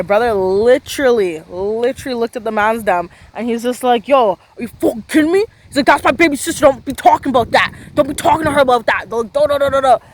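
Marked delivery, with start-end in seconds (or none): 4.1-5.4 raised, indignant mock outburst; imitated freaking out. Storyteller as brother confronting the man who dismissed his sister, freaking out. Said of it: "He's, like, freaking out". 5.9-10.0 higher-pitched, heated mock rant; imitated freaking out. Storyteller as brother defending his baby sister, freaking out. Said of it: "He's, like, freaking out".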